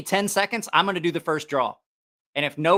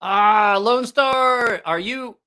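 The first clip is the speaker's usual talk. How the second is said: hyped giveaway announcement; 4 semitones higher, 3 dB louder, slower than usual. Real, not acted. Drawing the giveaway winner live.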